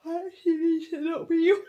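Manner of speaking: yawning and laughing over the words. Real, not acted. Telling viewers she must stop yawning, laughing. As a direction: giggle through a big yawn.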